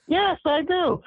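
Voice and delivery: mock eager answer, voice jumped way up; high eager yes. [name] answering [name], in a high eager squeak.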